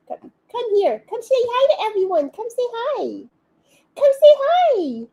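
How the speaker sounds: high sing-song voice for a pet. Real, not acted. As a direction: coo at the dog, very high. Calling the dog to camera.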